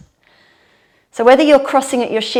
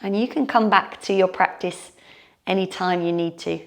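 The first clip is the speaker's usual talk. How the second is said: guided meditation; 3 semitones lower, 7 dB quieter than usual. Shown as a demonstration. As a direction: settle them into calm closing. Closing relaxation of yoga class.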